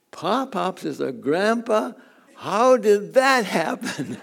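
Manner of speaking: slow, rising, amused bewilderment; mock-wondering child, half laughing; childlike disbelief. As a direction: voice a puzzled little grandson. Grandson voiced in story.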